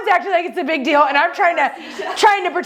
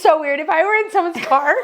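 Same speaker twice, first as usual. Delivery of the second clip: ordinary talk, nothing marked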